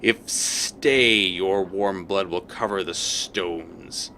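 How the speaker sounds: very slow, menacing fiend voice; snarling fiend threat. Acted. Devil threatens the intruder, slow menacing snarl. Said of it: "The creature snarls".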